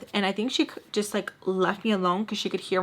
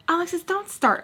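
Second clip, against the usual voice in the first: higher, louder mock scold; scolding snap; 10 semitones higher, 4 dB louder than usual. Baltimore telling Alexis to stop, higher louder mock scold. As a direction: cut her off sharply.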